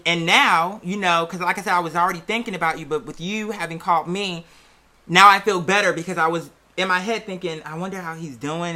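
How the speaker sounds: usual speaking voice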